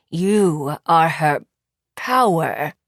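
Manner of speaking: very slow, low, through clenched teeth; gritted teeth. Acted. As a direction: squeeze each word out through teeth. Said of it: "slowly and through gritted teeth".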